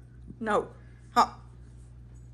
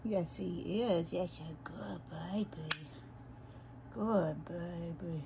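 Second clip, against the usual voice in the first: soothing a scared dog; 6 dB quieter, slower than usual. Real, not acted. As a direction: coo gently to the pet.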